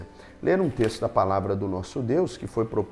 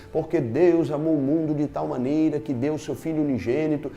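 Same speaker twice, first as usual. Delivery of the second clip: scripture quote building higher and louder; preaching fervor; 4 semitones higher, 3 dB louder than usual. Acted. Preacher quoting John three sixteen, rising fervor.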